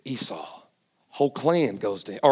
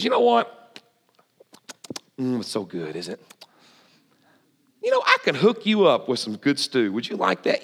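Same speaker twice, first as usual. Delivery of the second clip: sly tempting brother. Retold or imitated. Jacob tempts his starving brother with stew, slyly. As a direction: dangle the stew like a con man, murmuring.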